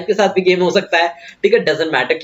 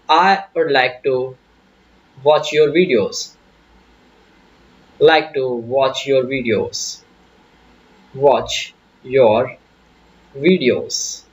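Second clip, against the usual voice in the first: very slow dictation; 5 semitones lower, much slower than usual. Shown as a demonstration. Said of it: "आपने बताया".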